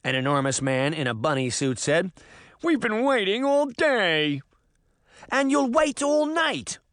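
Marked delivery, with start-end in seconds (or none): 2.6-4.4 high, slow, whining complaint; whiny big man. Acted. Bunny-suit man complains. 5.3-6.8 put-on high, slow, indignant English boy; Tim crying out. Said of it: "Tim cried".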